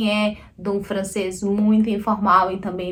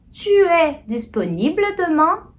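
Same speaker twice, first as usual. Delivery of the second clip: slow, higher question tune rising on "demain"; tom de pergunta; 6 semitones higher than usual. Shown as a demonstration. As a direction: draw out "Tu es disponible demain?" and lift the ending. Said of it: "o tom da voz que sobe assim". (pergunta lenta, voz subindo em "demain")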